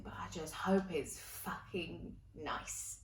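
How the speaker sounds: soft, slow repeat. Shown as a demonstration. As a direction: let the line sink in gently and slowly. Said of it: "like I just said".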